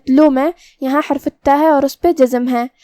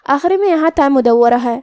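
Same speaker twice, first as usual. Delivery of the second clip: ordinary talk, nothing marked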